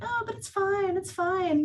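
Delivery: lightly mocking it's fine; mock brush-off. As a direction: parody someone waving it away. Mocking dismissive phrase.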